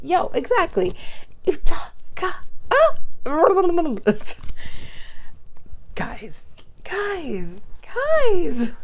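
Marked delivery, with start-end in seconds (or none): 2.0-5.9 high, loud laugh of delight; burst of high laughter. Real, not acted. Reacting to the oracle card, a delighted high laugh. 5.9-8.7 from a whisper to a loud high call; building excitement. Real, not acted. Calling out to the viewers, rising from a whisper to an excited shout.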